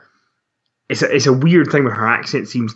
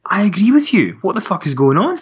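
high-pitched, exasperated disbelief; acted bafflement; 9 semitones higher than usual. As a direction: react in baffled agreement, voice pitched way up. Acting out her baffled viewer reaction.

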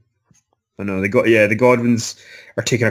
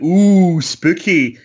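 drawn-out teasing ooh, a little louder; [name] mock-spooked; 4 semitones higher, 6 dB louder, much slower than usual. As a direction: playfully pretend to be spooked. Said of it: "[name]'s doing the sort of".

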